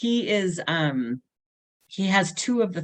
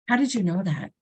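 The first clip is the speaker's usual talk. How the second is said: small gasp, then a hushed, amazed question; imitating near tears; 3 semitones higher than usual. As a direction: act out a child touched almost to tears. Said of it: "he was, like, almost crying".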